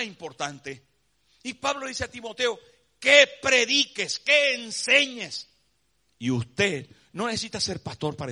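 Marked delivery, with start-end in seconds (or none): 3.0-5.5 louder, higher and slow; solemn charge. Acted. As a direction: charge him slowly and firmly. Pablo exhorting Timoteo, quoted. (Pablo a Timoteo: exhortación lenta y fuerte)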